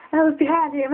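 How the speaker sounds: ordinary talk, nothing marked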